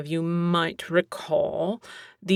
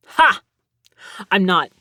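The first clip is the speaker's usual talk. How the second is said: ordinary talk, nothing marked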